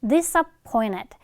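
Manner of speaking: "disappointed" as disa-POI-ned, slowly modelled; t assimilated into n. Shown as a demonstration. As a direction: drop the t after n in "disappointed". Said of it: "동화가 돼서".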